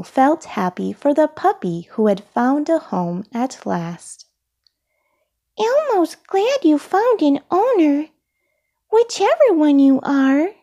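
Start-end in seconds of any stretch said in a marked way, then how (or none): 5.6-10.5 high-pitched puppet voice; high childlike character; Elmo. A narrator's reading. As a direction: address the puppies in a small high voice.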